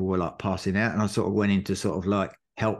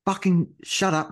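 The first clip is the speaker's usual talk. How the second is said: higher, sharp self-scolding; scolding himself; 9 semitones higher than usual. Retold or imitated. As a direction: snap at your own doubts mid-race. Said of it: "And I went".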